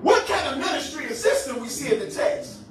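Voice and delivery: high, emphatic sermon cadence; impassioned preaching. Real, not acted. Preacher challenging arrogant church leaders over hurting people, impassioned preaching. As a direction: challenge them in weighty, separated phrases.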